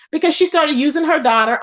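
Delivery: normal talking voice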